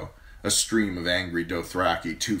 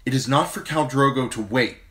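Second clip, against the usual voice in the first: higher, louder queenly proclamation; proclaiming; 7 semitones higher, 6 dB louder than usual. Acted. Queen proclaims her order before the riders, higher louder. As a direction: proclaim it to all. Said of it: "she proclaimed".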